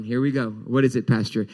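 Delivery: ordinary talk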